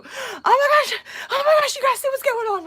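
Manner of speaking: high, loud excited squeal; mock freaking out. Coworker washing window gawks at jogger, freaking out.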